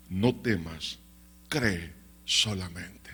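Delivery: low, slow, solemn comfort; calm reassurance. Acted. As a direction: reassure with deep, unhurried calm. Jesus reassures the bereaved father. (consuelo grave, lento y solemne)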